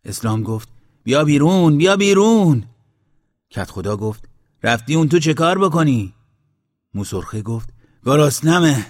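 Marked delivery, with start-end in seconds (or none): 1.0-2.6 high, loud urgent call; calling out loudly. A narrator's reading. Calling someone out of pool. 4.6-6.1 loud, raised-pitch scolding call; shouted question. A narrator's reading. Headman calls to man in pool. 8.0-8.9 loud, high cry of hunger; hungry shout. A narrator's reading. Hungry man shouts back from pool.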